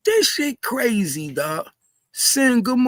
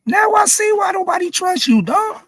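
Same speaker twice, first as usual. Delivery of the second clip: higher, raised ranting yell; angry rant; 7 semitones higher than usual. Real, not acted. Ranting at the rapper.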